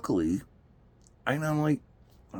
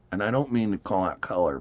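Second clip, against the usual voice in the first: usual speaking voice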